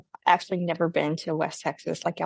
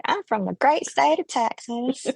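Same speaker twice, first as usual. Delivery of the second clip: higher, proud Texan pronouncement; proud Texan declaration; 6 semitones higher than usual. Retold or imitated. Texan answering out-of-state people, proud declaration. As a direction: announce it with Texan pride. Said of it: "I'm like".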